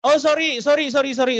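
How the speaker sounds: high, quick apology on catching himself; sudden realization. Real, not acted. Host cuts off his own closing with a startled apology. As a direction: stop short, remembering the quiz.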